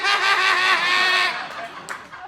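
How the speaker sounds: very loud, high cackle; Ralphie. Retold or imitated. Headliner laughing at the panicking comic, loud high cackle. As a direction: imitate a long roaring laugh at someone's misery. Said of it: "He's like".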